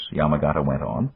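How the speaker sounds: ordinary delivery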